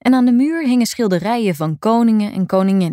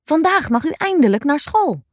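excited; 8 semitones higher than usual. Acted. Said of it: "riep Willem".

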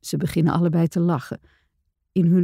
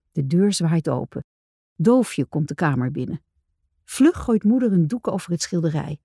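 ordinary delivery, nothing marked

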